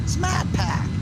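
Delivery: "Smat Pack" called out high with a dropped R, hammed-up Boston; Boston accent. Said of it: "authentic native Bostonians".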